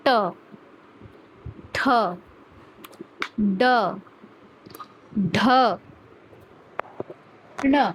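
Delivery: ट ठ ड ढ ण each said slowly alone, tongue curled up to the roof of the mouth; retroflex letter demo. Shown as a demonstration. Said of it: "आप खुद महसूस करेंगे कि ये ऊपर लग रहा है". (मूर्धन्य उच्चारण, जीभ ऊपर लगाकर)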